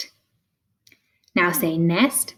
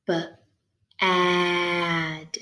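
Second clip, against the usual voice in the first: B, then a long stretched ad; said slow; 4 dB quieter than usual. Shown as a demonstration. Teacher helping find the middle sound, said slow. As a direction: stretch out the vowel in B, ad. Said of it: "You can say it slow like that".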